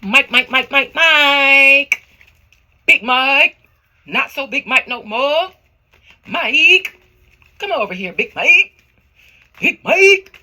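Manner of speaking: loud, high calls rising at the end; summoning call. Pretend. Psychic conjuring Mike's spirit, loud rising call. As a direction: call out the name like hailing a spirit. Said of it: "say it like that".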